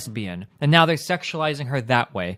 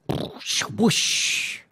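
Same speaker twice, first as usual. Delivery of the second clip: breathy voiceless whoosh effect; airy comic sound effect; imitated whoosh; 4 semitones higher, 6 dB quieter than usual. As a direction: make a rushing-air noise with your mouth.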